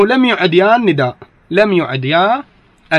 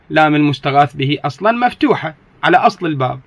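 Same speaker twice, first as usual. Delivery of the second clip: normal talking voice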